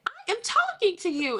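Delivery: mother reprimanding her daughter, sternly; stern mom. Retold or imitated. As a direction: insist on being heard.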